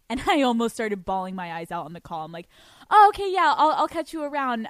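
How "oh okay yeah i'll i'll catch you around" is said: forced cheer. Retold or imitated. Hiding disappointment on the call.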